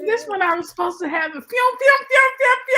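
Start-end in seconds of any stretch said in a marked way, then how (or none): 1.5-2.8 loud high pew pew sound effect; shouted pew pew. Pretend. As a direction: shout the laser noise.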